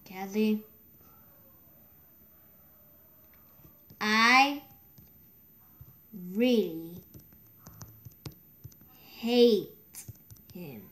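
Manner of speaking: very slow, halting, word by word; dictating while typing. Real, not acted. Player typing about someone who told, dictating slowly. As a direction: murmur it in fragments with long pauses.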